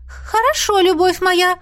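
put-on very high ghost girl voice; obedient ghost. A narrator's reading. Ghost told not to bother the girl, high-voiced. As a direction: agree high, as an adoring ghost.

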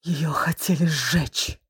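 slow, eerie whisper. A narrator's reading. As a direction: hush it like a dark secret. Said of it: "добавила жутким шёпотом".

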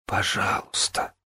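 faint whispered plea; pleading whisper. Acted. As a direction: beg in a slow whisper. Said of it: "взмолилась умирающая".

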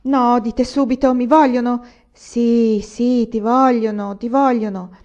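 eager, breathless urgency; pressing for the news, voice up; excited. Acted. Excited exchange about the news.

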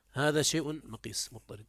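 normal talking voice

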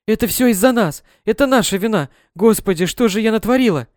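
отчаянный крик, выше и громче; мать в ужасе кричит о своей вине; кричит в голос. Acted. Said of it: "мама кричала в голос". (мать кричит над утонувшей дочерью, выше и громче)

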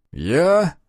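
plain reading voice

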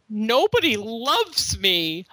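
slow, put-upon self-pity; imitating a sulky son milking it; melodramatic whine. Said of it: "You're just a little melodramatic".

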